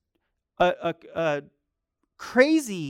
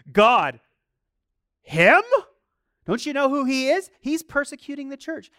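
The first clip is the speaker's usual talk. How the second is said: mock disbelief; 7 semitones higher, 7 dB louder than usual. Ananias protesting to God.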